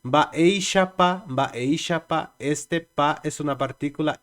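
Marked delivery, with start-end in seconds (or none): 0.0-2.3 "Mbaeixapa" said slowly, syllable by syllable; slow Guarani phrase. Shown as a demonstration. Teacher to students, modelling "Mbaeixapa" slowly. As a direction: stretch out "mbaeixapa" for learners. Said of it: "en guaraní le vamos a decir".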